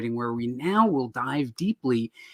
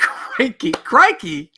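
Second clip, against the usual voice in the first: high, loud Aussie exclamation; hammed-up Aussie accent, pitched high; mock Australian accent; 9 semitones higher, 6 dB louder, much slower than usual. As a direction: mimic an Australian with gleeful exaggeration. Echoing the co-host's Australian exclamation.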